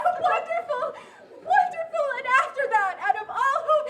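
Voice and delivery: a squealing, laughing cheer; delighted. Acted. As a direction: burst out with joy. Nurse delights in the news.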